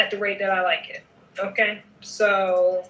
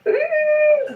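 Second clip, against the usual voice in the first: much higher, louder delighted ooh; 6 dB louder than usual. Real, not acted. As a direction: squeal it up high in delight. Sees the sweater grow.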